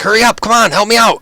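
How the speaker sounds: high, loud, frantic plea; frantic high-pitched hollering; urgent shout for help. Acted. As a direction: yell for backup mid-struggle. Ront begging for help mid-fight.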